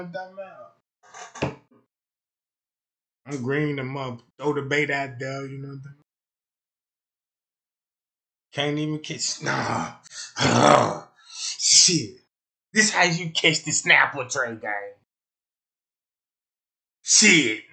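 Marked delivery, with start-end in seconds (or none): none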